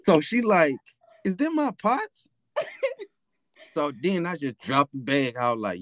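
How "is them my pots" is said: much higher mock mom, suspicious; mom's suspicious question. Mom hears her pots rattling in the bag, high mock suspicion. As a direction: play the mother spotting her own pots.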